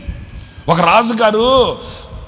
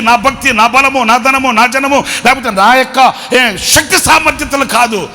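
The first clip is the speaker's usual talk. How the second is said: loud, rising preacher's cry; fervent preaching; 5 semitones higher, 6 dB louder than usual. Acted. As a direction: preach it out at full force to the congregation. Fervent preaching, shouted hallelujah.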